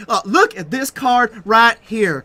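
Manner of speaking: gushing hammed-up card hype; Evan Irwin impression. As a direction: oversell the card like an overexcited streamer. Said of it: "I'm not trying to Evan Irwin this card".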